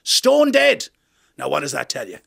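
preaching priest. Retold or imitated.

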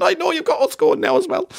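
high, tearful mock old lady voice; old ladies crying. Comedian imitating weeping elderly fans, high pitched. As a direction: squeak it out like teary old ladies. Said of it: "They're going".

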